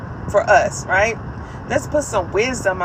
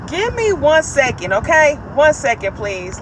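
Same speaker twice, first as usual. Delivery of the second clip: calling out; 5 semitones higher, 5 dB louder than usual. Real, not acted. Calls out to someone off-mic.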